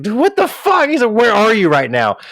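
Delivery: high-pitched, incredulous dad on the phone; imitating a flabbergasted parent; retold shock. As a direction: act out a stunned father's outburst. Acting out dad's shocked reply.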